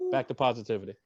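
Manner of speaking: ordinary talk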